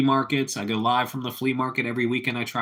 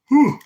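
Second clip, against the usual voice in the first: loud high yawning exhale; big yawn; 11 dB louder than usual. Real, not acted. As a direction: let out a long loud yawn.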